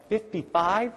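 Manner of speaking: put-on unsure kid. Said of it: "The kid'll go".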